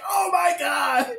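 high, drawn-out horrified cry; mock horror. Viewer reacting to a creepy smile, mock horrified cry. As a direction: cry out slowly in hammed-up alarm. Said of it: "I'm like".

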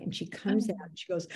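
usual speaking voice

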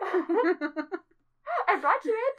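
dopey creature voice. Acted. Playing hellhound presenting soul.

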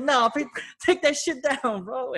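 high-pitched, panicky begging, retold; mock frantic plea. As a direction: beg in comic panic, voice way up.

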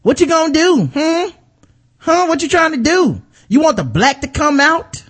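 hammed-up in-your-face challenge; mock confrontation. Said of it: "the other girl's like".

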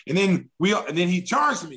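ordinary delivery

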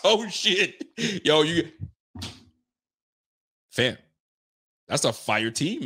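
ordinary talk

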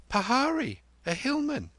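put-on very high small boy; high-pitched little know-all; little boy Chota Lal. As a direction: pipe up in a high child's voice. Narrator voices little Chota Lal.

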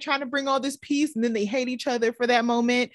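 ordinary talk, nothing marked

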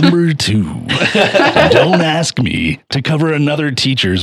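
high hyped announcer call; fighting game announcer. Pretend. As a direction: boom it out like an arena announcer. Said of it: "Will you talk in your announcer voice more?".

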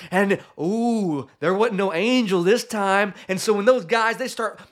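high-pitched narration building to a peak; animated, rising climax; excited storytelling. A narrator's reading.

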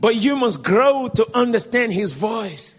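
forceful, projected exhortation; loud preaching. Retold or imitated. Preacher urging believers to grow, loudly. Retells preacher addressing the crowd.

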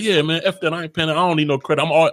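lightly voiced cocky brush-off; a hint of swagger, waving it off; arrogant younger self. Young man bragging he needs no credit, lightly cocky. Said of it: "I'm like".

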